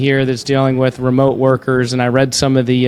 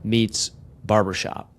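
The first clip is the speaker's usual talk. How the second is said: smooth, measured sponsor read; 3 semitones lower, 4 dB quieter than usual. Acted. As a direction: read it like a radio spot.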